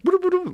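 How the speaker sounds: high sing-song boo-doo chant; imitated jungle chant. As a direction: chant it like a guide leading the way. Guide leads them through jungle.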